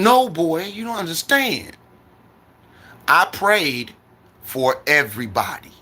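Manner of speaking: put-on a fed-up aunt correcting the boy; scolding aunt. Aunt corrects her nephew about her prayers, firmly. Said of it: "when she mad at me".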